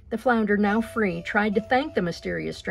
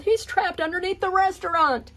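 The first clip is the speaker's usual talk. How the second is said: high alarmed yell; 11 semitones higher, 4 dB louder than usual. Acted. Lobster yelling the alarm to the others. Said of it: "the lobster yelled".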